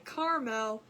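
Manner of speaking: caramel as CAR-mel in two syllables. Retold or imitated. Host puzzled by viewers' way, caramel as CAR-mel. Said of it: "how you people say caramel".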